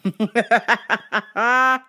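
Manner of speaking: loud laugh. Real, not acted. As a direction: laugh at yourself. Laughing at himself.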